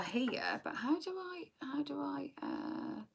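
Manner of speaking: whispering to herself while searching for the comments; voiceless murmur to herself. Real, not acted.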